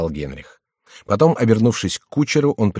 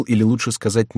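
usual speaking voice

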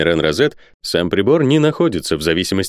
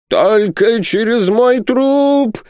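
very high, long-drawn wail; 4 dB louder, much slower than usual. A narrator's reading. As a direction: wail each word out in desperation. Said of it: "голосил Исаак Хакабут".

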